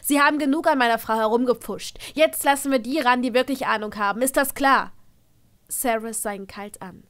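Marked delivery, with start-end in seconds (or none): 0.0-4.9 loud, fast furious rant; father berating the meddling wizard, furious and loud; angry father. A narrator's reading. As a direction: tear into him and lay down the law.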